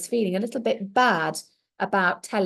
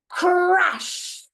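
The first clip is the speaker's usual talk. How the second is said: crash; 8 semitones higher, 4 dB louder than usual. A narrator's reading. Ball breaks the clock.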